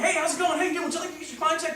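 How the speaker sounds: mock high, loud, eager salesman greeting; hammed-up pushy enthusiasm; overly enthusiastic. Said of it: "you don't come at 'em with enthusiasm".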